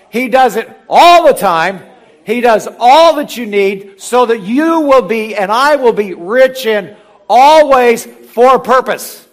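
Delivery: loud, high, slow preaching with the word all hammered; a booming pulpit climax on God's generosity; full-force preaching. Acted. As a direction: preach it out to the back row.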